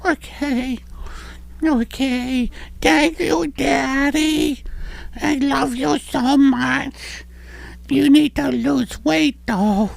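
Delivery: put-on high little boy voice; kid voice. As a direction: play a sweet little boy. Child praying bit.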